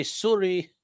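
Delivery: Canadian accent on Sorry, rounded soor-ee; mock Canadian accent saying Sorry. Shown as a demonstration. Telling chat how Canadians say Sorry, in a light Canadian accent. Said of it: "And you just gotta say".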